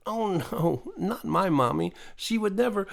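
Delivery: a hint of a child defending her mommy; mildly voiced young girl protesting; blurting little girl. A narrator's reading. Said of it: "she blurted out".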